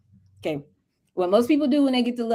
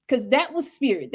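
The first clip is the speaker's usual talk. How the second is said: normal talking voice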